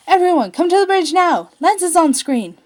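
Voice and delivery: loud, high urgent call; urgent shout. Acted. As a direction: shout it across the ship. Calling crew over speakers.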